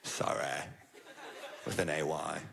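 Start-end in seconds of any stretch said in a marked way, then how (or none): 0.0-0.6 a flat, lowered, throwaway sorry; insincere apology. Retold or imitated. Comic mocking the agent's fake apology, flat and insincere. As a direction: say it like you don't mean it at all. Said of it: "The least sincere of all apologies".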